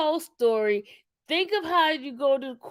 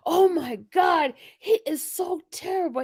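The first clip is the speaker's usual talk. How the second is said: mock shocked gasp; 3 semitones higher than usual. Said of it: "we're like, gasps".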